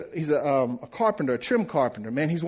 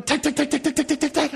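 high, rapid-fire staccato noise; imitated nail gun; 11 semitones higher than usual. Man telling how the carpenter nailed molding, imitated nail gun.

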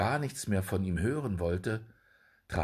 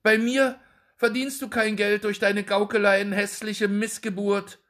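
scornful anger; 11 semitones higher, 5 dB louder than usual. A narrator's reading. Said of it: "zürnend".

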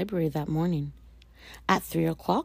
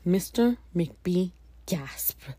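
plain reading voice

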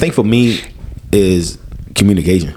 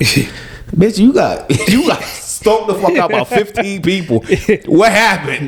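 higher-pitched incredulous outburst; incredulous confronting; 9 semitones higher, slower than usual. Acted. Confronting a drunk partner about last night, incredulous. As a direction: press them in high-pitched disbelief.

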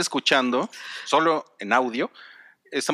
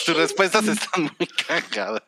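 amused, laughing as you speak; 5 semitones higher than usual. Real, not acted. Host teasing a guest about her answers, laughing. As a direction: talk through real laughter, pitched up.

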